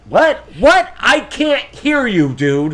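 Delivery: loud, high, mock yelling; shouting what. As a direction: yell it like you can't hear. Hero straining to hear.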